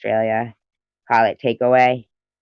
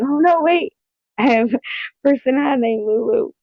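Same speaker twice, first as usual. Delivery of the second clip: sudden realisation; 12 semitones higher, 5 dB louder than usual. Retold or imitated. Sudden realisation mid-yell.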